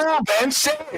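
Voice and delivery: furious taunting demand; angry demand. Real, not acted. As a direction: bark the name at him. Heated argument over religion.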